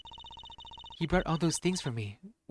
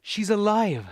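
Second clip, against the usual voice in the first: higher, louder joyful cry; excited shout; 6 semitones higher, 5 dB louder, slower than usual. Acted. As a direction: call it out with joy. Repeats louder.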